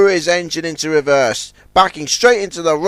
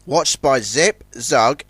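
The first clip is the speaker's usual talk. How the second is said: usual speaking voice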